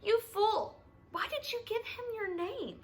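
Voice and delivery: high, exasperated self-rebuke; scolding herself. A narrator's reading. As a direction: scold yourself, voice pitched right up. Girl inwardly scolds herself.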